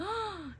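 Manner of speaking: sharp high gasp; mom's shocked gasp. Retold or imitated. Mom reacts to baby popping.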